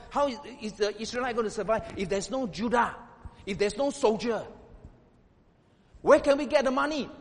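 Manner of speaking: loud, high-pitched fervent preaching; preaching at full force. Acted. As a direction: build to a booming call to the congregation.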